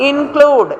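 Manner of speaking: Include with the second syllable CLUDE punched; weak in, strong CLUDE in include; include, stress on the second syllable. Shown as a demonstration. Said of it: "സിയുടെ മേളിലാണ്".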